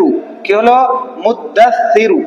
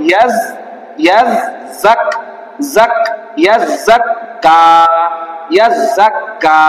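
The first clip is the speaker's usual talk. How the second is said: slow spelling out. Shown as a demonstration. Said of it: "আমাদের বানাম কি হবে?".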